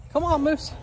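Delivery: ordinary talk, nothing marked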